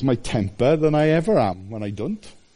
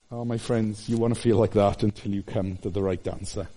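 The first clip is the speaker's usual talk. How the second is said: tender; 6 semitones lower, 6 dB quieter than usual. Real, not acted. Preacher turns tender to congregation.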